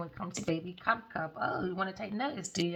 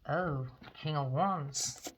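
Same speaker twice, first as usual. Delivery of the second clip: normal talking voice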